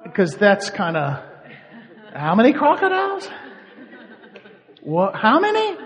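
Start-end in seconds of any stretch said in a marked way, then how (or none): 2.2-5.8 high, loud, laughing "how many crocodiles?"; laughing disbelief. Retold or imitated. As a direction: ask it half-laughing in mock alarm. Mock alarm about crocodile census.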